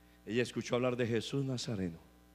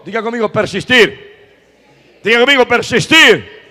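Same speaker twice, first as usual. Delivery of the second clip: loud, raised congregation prompt; rallying call; 7 semitones higher, 19 dB louder than usual. Real, not acted. Preacher to congregation asking them to repeat, rallying shout.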